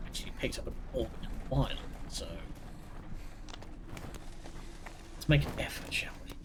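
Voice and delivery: breathy stealth whisper. Real, not acted. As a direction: whisper it so the enemy won't hear. Stealth play, whispering to himself.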